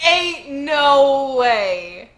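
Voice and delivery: slow, loud stunned disbelief; real amazed reaction. Real, not acted. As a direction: drag it out in shock. Reacting to Bon Clay return.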